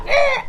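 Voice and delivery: high sharp mock shriek; imitating the witch's power blast. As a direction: yelp it out high and short. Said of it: "she went".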